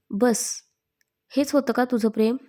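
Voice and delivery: ordinary delivery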